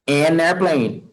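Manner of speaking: an airplane said slowly, an clearly linked; slow model phrase. Shown as a demonstration. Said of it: "isso, usa o N". (modelo lento para a turma)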